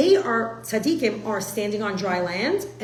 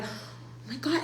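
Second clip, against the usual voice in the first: stunned hush; 4 semitones higher, 9 dB quieter, much slower than usual. Retold or imitated. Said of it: "And I was like".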